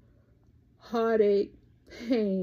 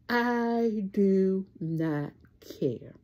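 drawn out word by word, settling on care; slow emphatic catchphrase; much slower than usual. Real, not acted. Vlogger to her viewers, slow whispered catchphrase.

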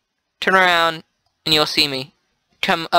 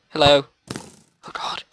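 normal talking voice

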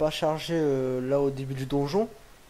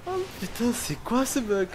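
high laughing panic; panicking gamer; 8 semitones higher than usual. Real, not acted. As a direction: squeal "Oh putain" in disbelief. Gamer stuck in a glitch.

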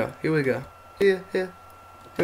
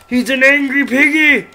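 put-on baby talk; 11 semitones higher, 8 dB louder than usual. Baby-talking the pig mob.

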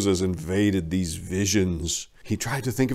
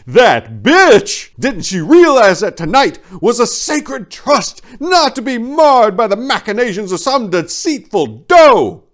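high, loud outraged fuming; furious inner rant; 12 semitones higher, 8 dB louder than usual. A narrator's reading. Reindeer fuming inwardly at the deceitful doe, high and loud in outrage. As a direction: seethe with indignation at her treachery. Prancer fumes at Vixen's betrayal.

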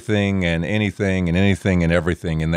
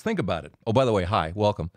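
normal talking voice

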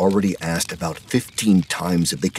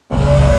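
roar; 7 dB louder than usual. Acted. Roar mid-narration.

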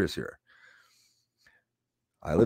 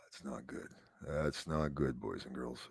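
much softer and lower; gloomy; 8 semitones lower, 17 dB quieter than usual. Acted. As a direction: mutter the bad news. Gloomy reaction to ratings drop.

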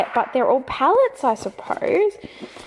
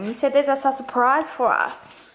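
usual speaking voice

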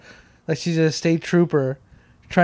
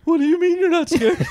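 laughing disbelief; 13 semitones higher than usual. Real, not acted. Incredulous at friend's claim.

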